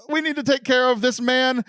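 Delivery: high, flustered hostess voice; stressed Martha. Retold or imitated. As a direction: fret about all the guests. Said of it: "She's like".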